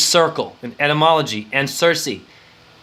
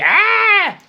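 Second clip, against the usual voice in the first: very high, loud, strained shriek; mock alien shriek; 4 dB louder than usual. Describing the Aliens movies, he shrieks like the creature. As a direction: screech like a movie monster.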